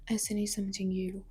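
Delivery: low, fast menacing line; cold threat. Acted. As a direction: mutter it darkly. Ishani decides to use force.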